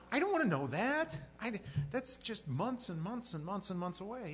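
high-pitched comic exasperation, dragging out the repeats; retold exasperation. Retold exasperation at child's countdown.

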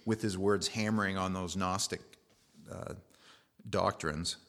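ordinary talk, nothing marked

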